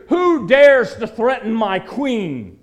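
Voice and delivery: loud, slow furious roar; king's furious demand. Acted. Enraged king roaring at the banquet, slow furious shout. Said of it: "In breaks the king with fury".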